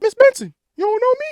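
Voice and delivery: squeaky high "don't you know me"; high-pitched mock plea to the owner; high pleading voice. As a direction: pitch way up, hurt and incredulous. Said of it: "I'm like".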